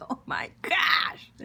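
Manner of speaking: high, loud breathy cry of delight. Real, not acted. Cook overwhelmed by the flavor, crying out. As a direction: cry out in ecstasy at the taste.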